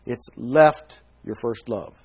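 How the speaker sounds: "left" drawn out and leaned on, slow and deliberate; slow it down and land hard on "left"; each word spaced, with the weight on "left"; stress on left. Shown as a demonstration. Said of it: "it's left the love, not lost the love".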